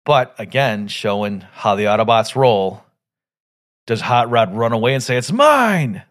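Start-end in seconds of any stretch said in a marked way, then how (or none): 5.2-6.0 high, louder snatching cry; snatch it like a selfish Decepticon; greedy Decepticon grab. Retold or imitated. Fan imitating a Decepticon crying it's mine. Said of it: "the way the Decepticon would".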